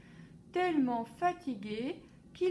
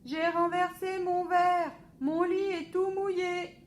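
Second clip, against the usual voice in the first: high loud singsong complaint; boy announcing the spill to papa; child shouting; 8 semitones higher, 8 dB louder than usual. A narrator's reading. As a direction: call out the mess in a singsong. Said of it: "crie Alphonse".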